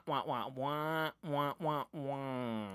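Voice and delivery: slow, soft Peanuts adult wah-wah; muted trombone wah. Retold or imitated. Peanuts parents' voice as muted trombone wah wah. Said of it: "that kind of muted trombone".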